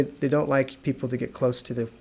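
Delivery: normal talking voice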